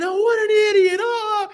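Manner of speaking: very high, loud taunting cry; hammed-up jeer through laughter; mocking jeer. Seniors mocking the freshman who shut himself out, jeering.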